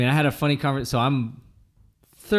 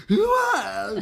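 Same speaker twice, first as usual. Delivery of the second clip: imitating wife's high voice; 4 dB louder than usual. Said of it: "And it's like".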